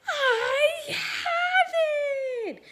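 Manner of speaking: very high, loud eureka shout; triumphant shout of a brilliant idea; sudden idea. Acted. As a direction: burst out with the discovery. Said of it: "suddenly he said".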